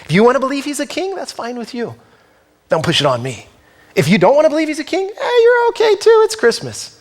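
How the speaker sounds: fast, high, breezy "whatever" voice; dismissive shrug. Retold or imitated. People who shrug off Jesus, fast flippant mock voice. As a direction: brush it off quickly, mock indifferent. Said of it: "Some people who say".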